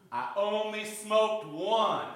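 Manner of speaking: slow, stretched-out mock announcement; drawn-out boast. Smoker reporting only one cigarette to the pastor, drawn-out boast. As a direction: stretch each word out slowly.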